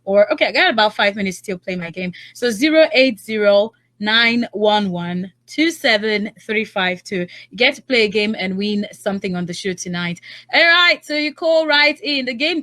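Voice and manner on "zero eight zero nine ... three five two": slow, spelled-out phone number; deliberate number reading; slow dictation. Real, not acted. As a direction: read each digit slowly for callers. Radio host slowly dictating phone number.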